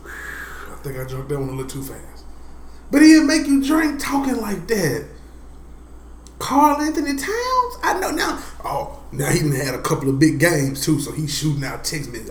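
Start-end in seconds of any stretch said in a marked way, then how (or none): 2.9-8.4 high, loud disbelief; loud incredulous ribbing. Real, not acted. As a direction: scoff at the pick in disbelief. Loud disbelief at Towns pick.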